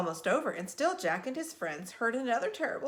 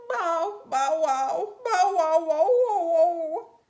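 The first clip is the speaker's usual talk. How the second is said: loud, high howling bow-wows; a dog howling through tears; crying dog; 8 semitones higher, 10 dB louder, much slower than usual. A narrator's reading. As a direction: wail the barks like a sobbing old dog. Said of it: "he saw a dog crying".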